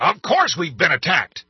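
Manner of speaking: high, loud angry bark. A narrator's reading. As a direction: bark it back furiously. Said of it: "the president barked".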